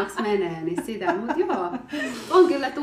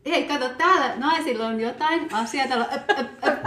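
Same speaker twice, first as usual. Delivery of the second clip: loud, higher jeering call; mocking heckler; 5 semitones higher, 5 dB louder than usual. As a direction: jeer at the women. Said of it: "Ja sitten oli sellainen". (pilkkaava, äänekäs huutelu)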